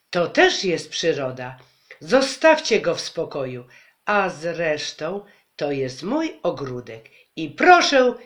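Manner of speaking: loud, indignant telling-off; mother loudly scolding the mob with indignation. Acted. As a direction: scold the crowd like a furious mother. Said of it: "umie krzyczeć głośniej".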